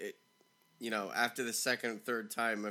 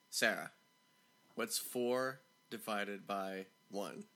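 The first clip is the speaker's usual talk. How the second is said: lower, slow, spaced-out question; slow, patient repeat; 3 semitones lower, 5 dB quieter, slower than usual. Retold or imitated. Teacher repeating to a stuck pupil, slow and deliberate. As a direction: repeat it slowly, word by word.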